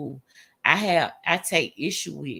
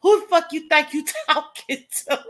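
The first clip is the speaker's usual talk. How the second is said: mock angry telling off; 7 semitones higher than usual. She mimics cussing out a caller, mock angry. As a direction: play at snapping at a scam caller, laughing. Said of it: "you be like".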